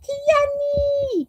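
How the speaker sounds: high, drawn-out name; chanting a chat member's name; sing-song name. Retold or imitated. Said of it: "the lovely Chantel say".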